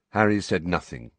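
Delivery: usual speaking voice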